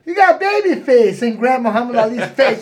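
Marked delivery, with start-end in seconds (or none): 0.0-1.2 higher, louder mocking tease; teasing taunt. Boxer teasing Muhammad Ali, loud and mocking. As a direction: needle him playfully. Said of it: "he just like".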